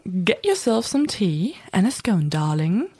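mock posh British English; British accent. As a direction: ham up a proper British accent. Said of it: "meinen perfekten britischen Akzent mal mehr auspacken".

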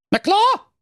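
high, pompous little king calling out; King Curry. A narrator's reading. Currywurst king calling Thorsten over on the steps, high and bossy.

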